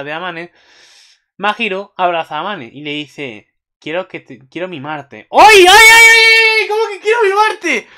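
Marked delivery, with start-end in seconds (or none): 5.3-7.9 very high, loud string of "ay"; ecstatic fan scream. Real, not acted. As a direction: shriek in thrilled disbelief. Screaming reaction to episode preview.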